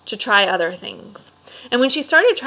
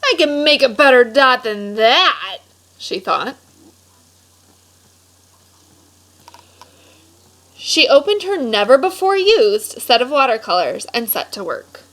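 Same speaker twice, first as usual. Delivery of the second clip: lively storybook read-aloud voice; animated picture-book narration; reading to children; 3 semitones higher, 4 dB louder than usual. A narrator's reading. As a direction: read it like story time for a class.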